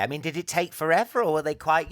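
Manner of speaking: normal talking voice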